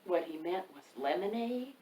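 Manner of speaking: usual speaking voice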